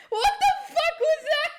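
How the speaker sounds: high, loud disbelieving squeal; laughing shriek. Real, not acted. Streamer appalled at own accent, laughing shriek. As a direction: yell it while cracking up.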